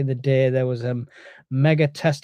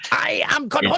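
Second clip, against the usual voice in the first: much higher cartoon voice; Cornholio impression; 12 semitones higher than usual. Showing his cohost the Beavis and Butthead bit, high cartoon squeal. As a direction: squeal it out like a hyper cartoon teen. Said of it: "have you not seen Beavis and Butthead? That's what it's from".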